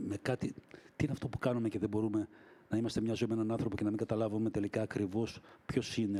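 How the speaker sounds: normal talking voice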